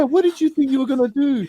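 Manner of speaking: high-pitched disbelieving question; incredulous. Real, not acted. Friend repeats disbelieving challenge.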